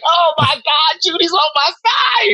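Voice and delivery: high, joyful shout; happy shout. Retold or imitated. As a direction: shout with delight, the judge is on her side. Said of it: "I was like".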